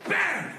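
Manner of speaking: high, sudden "bam" for the landing; acted slam sound; impact noise. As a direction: punch it out like a crash. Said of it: "You go".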